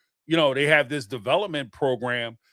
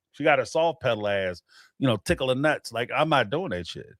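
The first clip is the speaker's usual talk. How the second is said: ordinary talk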